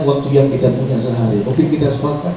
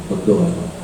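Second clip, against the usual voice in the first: calm mother; 9 semitones lower, 9 dB quieter than usual. Retold or imitated. Mother answers her son.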